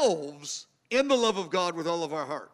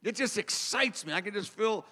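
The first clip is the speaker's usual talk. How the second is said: usual speaking voice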